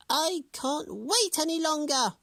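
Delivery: high, loud screeching hag; screeching witch. A narrator's reading. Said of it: "she screeched".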